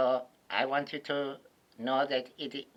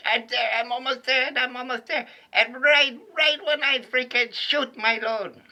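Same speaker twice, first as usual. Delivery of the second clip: rising eagerly toward the moment; building excitement; 8 semitones higher, 3 dB louder than usual. Retold or imitated. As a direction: voice climbing higher and louder to the peak. Building to climax, retold.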